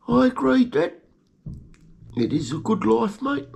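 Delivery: squeaky high little-rat voice, cheerful; pet rat's voice. Pretend. Pet rat Greggy answering his owner, squeaky and high. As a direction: answer Dad as a tiny proud rat.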